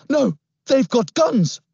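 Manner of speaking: high, slow, frightened plea; panicked boy. A narrator's reading. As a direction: beg him to stop, terrified. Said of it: "I gasped".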